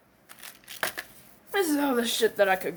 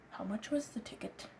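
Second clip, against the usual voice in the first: whispered question; 3 semitones lower, 17 dB quieter than usual. Acted. Friend quietly asking the ticket price, whispered. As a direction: whisper it, much softer.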